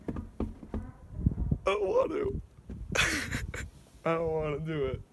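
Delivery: very high, slow whine; whiny protest. Pretend. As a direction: whine it like a kid. Whining about sharing body heat.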